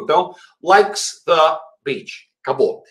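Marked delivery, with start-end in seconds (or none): 0.6-2.3 slow likes the beach, words kept apart; slow, lightly separated words; subtle separation. Shown as a demonstration. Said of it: "não se misturam".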